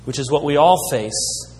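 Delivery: usual speaking voice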